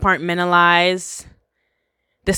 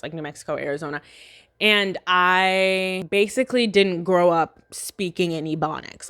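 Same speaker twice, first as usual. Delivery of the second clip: ordinary talk, nothing marked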